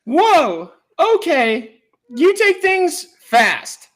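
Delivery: high falsetto, the last line slow; man playing a coy woman, high; lady voice. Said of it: "do your best lady voice".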